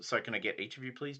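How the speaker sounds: ordinary talk, nothing marked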